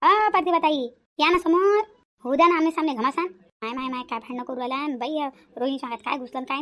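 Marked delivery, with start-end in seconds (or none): 0.0-3.3 loud and higher, calling her to come out and face me; taunting call-out. Acted. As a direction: hurl the challenges across the house, mocking. Rohini calling out again. (जोरात टोमणे मारत हाक)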